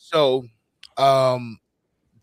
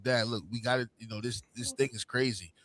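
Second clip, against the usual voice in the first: low, soft retold heart-to-heart; serious appeal; 6 semitones lower, 11 dB quieter than usual. Son confronting his dad, quiet and serious. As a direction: drop down and level with him quietly.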